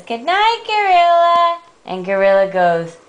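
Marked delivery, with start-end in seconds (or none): 0.0-1.6 high, loud little mouse voice; tiny mouse. Acted. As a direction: squeak it high and draw it out. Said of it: "And Mouse says".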